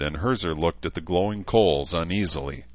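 ordinary delivery

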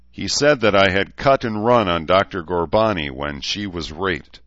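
ordinary delivery

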